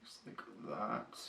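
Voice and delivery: very soft, unvoiced whisper; absorbed whisper. Real, not acted. Vlogger blending eyeshadow to the edge, whispered. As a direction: whisper it while concentrating on the work. Murmuring while applying eyeshadow.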